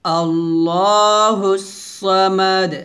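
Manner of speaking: very slow, stretched tajweed recitation of আল্লাহুস Samad; slow Quran recitation. Shown as a demonstration. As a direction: draw every sound out slowly as a model. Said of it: "আবার".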